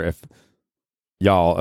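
ordinary talk, nothing marked

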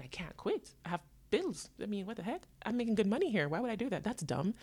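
normal talking voice

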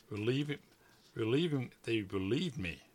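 ordinary delivery

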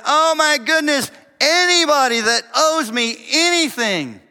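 loud, high-pitched elated outburst; amazed, shouted gratitude; overjoyed servant. Acted. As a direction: proclaim it as if bursting with relief. Acts out the overjoyed forgiven servant.

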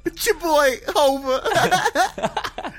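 high, loud rap ad-lib parody; hype man. Acted. Riffing on Ocelot's hype men, hype-man voice. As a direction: shout it out like a hype man, cracking up. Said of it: "just his hype, his hype men".

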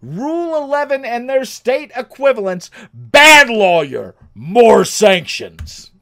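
loud, slow showman announcing the topic; dramatic announcement. Acted. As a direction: boom it out like a game show host, dragging the last words.

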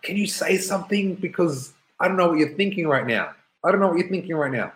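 high-pitched, desperate retold plea; anxious pleading. Teen begging silent mum to speak.

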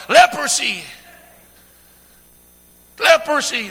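mild mock yell; acted yell. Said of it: "they had to yell out".

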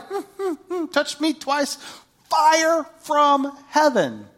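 high, slow, smug drawl of fire from heaven; smug bragging. Pretend. Preacher imagines herself bragging, high and slow. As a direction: savour every word like a showoff. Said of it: "I'd be a little like".

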